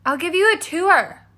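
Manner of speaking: put-on high, cheerful girl; Cindy's voice. A narrator's reading. Cindy offering her stepmother a tour, cheerful. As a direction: chirp it brightly.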